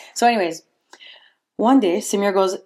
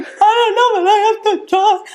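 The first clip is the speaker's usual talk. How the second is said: high, loud desperate voice; imitating her frantic plea to her dad; frantic retelling; 12 semitones higher, 4 dB louder than usual. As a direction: replay her panicked younger self. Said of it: "And I'm like".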